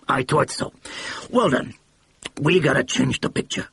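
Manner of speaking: a blustering mogul catching his mistake; excited brash producer. A narrator's reading.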